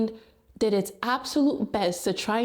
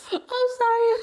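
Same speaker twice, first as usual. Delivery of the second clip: a high, louder apology through laughter; laughing apology; 4 dB louder than usual. Real, not acted. Apologising to viewers while cracking up, laughing. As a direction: say sorry while still laughing.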